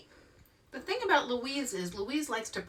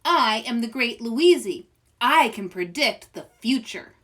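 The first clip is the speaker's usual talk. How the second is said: grand proclamation; 2 semitones higher, 8 dB louder than usual. Acted. Louise proclaims herself grandly.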